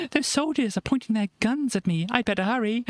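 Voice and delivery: put-on hurried little puppet girl; Runabine voice. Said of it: "she goes".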